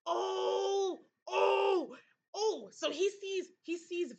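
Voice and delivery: high, loud cry of realization; overwhelmed as it dawns on her; emotional outcry. Real, not acted. Player realizes Thomas sees visions, high emotional outcry.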